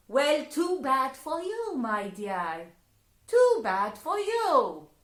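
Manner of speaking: high, loud mocking fairy voice; high, loud fairy gloating at the girl; taunting fairy. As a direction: gloat over her. Fairies taunt her.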